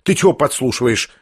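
ordinary delivery, nothing marked

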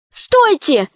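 put-on high, urgent girl's cry. A narrator's reading. Alisa rushes at the dragon, high cry to stop. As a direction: halt them with a sharp call. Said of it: "крикнула тогда Алиса".

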